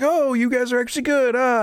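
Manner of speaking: high, mock-dawning realization; surprised realization. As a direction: act out a character suddenly realizing. Game character's surprised realization retold.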